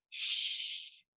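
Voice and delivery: whispered shush; dad's shush. Retold or imitated. Dad hushing the boys in a whisper. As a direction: hush them without voice. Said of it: "my dad goes".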